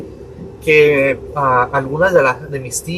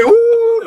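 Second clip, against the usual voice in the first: very high startled squeak; panicked squeak; 6 dB louder than usual. Retold or imitated. Son squeaking in panic before his father, very high. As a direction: squeak in alarm.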